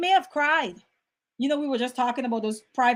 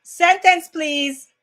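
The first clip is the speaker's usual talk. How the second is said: higher, crisp mock contestant request; spelling bee contestant; 3 semitones higher, 7 dB louder, much slower than usual. Playing a spelling bee contestant asking the judge, mock-formal. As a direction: playfully ask the judge for a sentence. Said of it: "when you're in the spelling bee".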